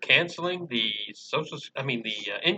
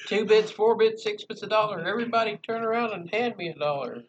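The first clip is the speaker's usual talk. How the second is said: cheerleader; 7 semitones higher than usual. Pretend. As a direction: shout it like a cheerleader on the sidelines. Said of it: "bad cheerleader cheers".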